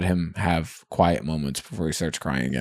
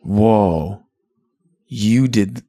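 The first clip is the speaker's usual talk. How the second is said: awed realization; much slower than usual. Retold or imitated. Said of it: "this crazy meta moment of".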